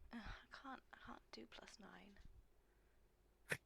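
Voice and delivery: slow soft whispered mutter; slow whispered groan at the maths. Real, not acted. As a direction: grumble under your breath.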